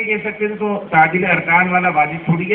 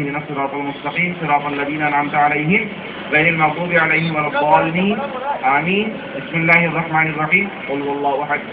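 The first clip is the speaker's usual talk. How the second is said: Quran recitation; 5 semitones lower than usual. Shown as a demonstration. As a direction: recite gently, as in prayer itself. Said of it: "ایسے پڑھتے ہیں نماز کے اندر".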